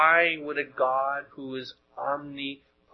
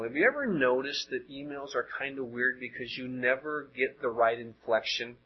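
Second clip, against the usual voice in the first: usual speaking voice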